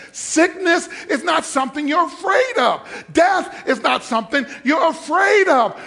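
preacher climbing to a shouted climax; preaching at full force. Acted. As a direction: build the fire of a preacher.

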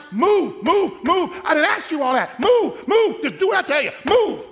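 loud, high repeated orders; preacher's command. Retold or imitated. Pastor ordering doubters forward, loud. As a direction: drive them on. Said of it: "I'm like".

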